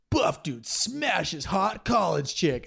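slow, drawn-out title read; hammed-up porn title announcement; video title read-out. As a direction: stretch it out like a lurid headline.

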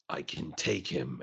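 low, quiet, slow delivery; Boba. Retold or imitated. Podcast host quotes Boba to friends, low and slow. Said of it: "you get Boba being like".

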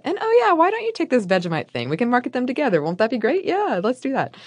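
high, quick, eager schmoozing voice; eager Walker voice. Retold or imitated. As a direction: play the keen businessman talking Kraft into a deal. Acting out Walker's pitch to Kraft.

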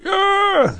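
sudden high-pitched whoop; excited yell. Retold or imitated. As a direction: whoop it out like a thrilled fan. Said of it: "David Faust is going".

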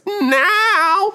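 an involuntary squeaky break in the voice; real, uncontrolled voice crack. Real, not acted. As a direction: voice cracks way up into falsetto mid-word. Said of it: "My voice is cracking".

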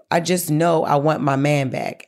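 ordinary talk, nothing marked

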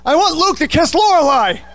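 high, loud sudden blurt. Retold or imitated. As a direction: let it burst out of you, too loud for the room. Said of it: "you just blurt out".

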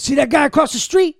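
high, loud street threat; mugger voice. Retold or imitated. Recounting the mugger's threat, high and loud. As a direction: bluff like a mugger pointing out backup.

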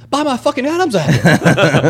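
loud, high, drawn-out protest; retold exasperated outburst; indignant shout. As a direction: yell it in comic outrage. Retold angry protest to the choker.

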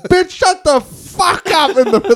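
mock yell. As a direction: hurl the insult at full volume. Acted angry quote continues.

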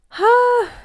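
high, loud sigh; big bracing exhale before a confession; nervous sigh. Real, not acted. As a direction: let out a sharp, high breath. Bracing before big pregnancy reveal.

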